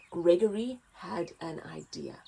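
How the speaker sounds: ordinary delivery, nothing marked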